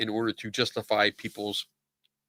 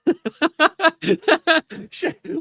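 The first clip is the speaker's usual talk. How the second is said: high laugh; 7 dB louder than usual. Real, not acted. Laughing at pronunciation.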